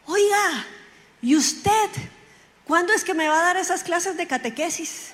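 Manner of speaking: high-pitched mock holler from a car; retold demanding call-out. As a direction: call her out, imitating an impatient friend. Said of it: "y me grita". (reclamo a gritos imitado, en tono agudo)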